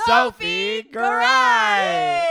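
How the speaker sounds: drawn-out high announcer call. Acted. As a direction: announce the guest like a game show. Announcing the guest grandly.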